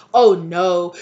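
loud, dramatic mock dismay; acted alarmed warning. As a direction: warn loudly in pretend horror. Voices karmic's alarmed warning.

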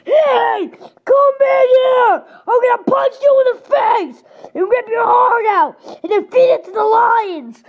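angry shouting. Acted. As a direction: yell the threats in a rage. Angry threats to an intruder.

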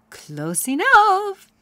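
put-on kindly old magical mare; fairy godmare. A narrator's reading. Fairy godmare greeting crying Poniella, high and grand. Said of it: "said the fairy godmare".

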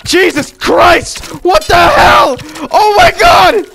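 very high, loud startled shouts; shocked screaming. Real, not acted. Sudden shock in the game.

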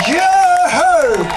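loud high mock cheer; cheering yoo-hoo. Preacher rallying his audience, a loud high whoop. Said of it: "clap for that and say".

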